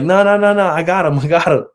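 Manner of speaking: high, loud, hurried-sounding protest, played up; retold frantic reassurance. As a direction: wave off the worry in an acted fluster. Acted hasty reassurance to the woman.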